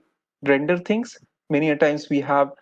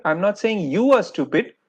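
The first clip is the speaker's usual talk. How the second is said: YOU lifted high in pitch; single out "you" from the rest; pointed emphasis on "you"; stress on YOU; 4 semitones higher than usual. Shown as a demonstration. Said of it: "the emphasis has shifted to you".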